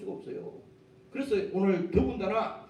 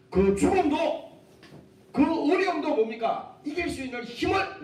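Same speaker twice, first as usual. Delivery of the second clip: preaching build; 8 semitones higher, 4 dB louder than usual. Real, not acted. Preacher builds with rising force. (천천히 높고 크게 외치는 설교)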